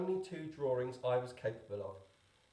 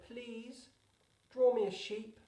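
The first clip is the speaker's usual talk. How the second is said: put-on a small child pleading, pitched much higher; strange little voice; 9 semitones higher, slower than usual. A narrator's reading. Little prince waking the pilot, high small child's voice. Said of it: "a strange little voice".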